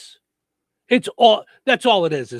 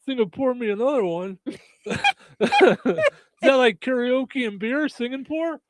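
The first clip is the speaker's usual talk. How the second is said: stoner voice; 7 semitones higher, slower than usual. Pretend. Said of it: "your best stoner voice".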